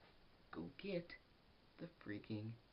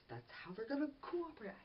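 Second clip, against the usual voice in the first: much higher, thrilled delivery; excited prediction; 14 semitones higher than usual. Real, not acted. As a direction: burst out with the big reveal.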